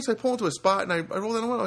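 normal talking voice

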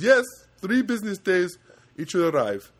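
put-on loud, high Indian accent. Agent confirming delivery time, imitated Indian accent. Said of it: "And he goes".

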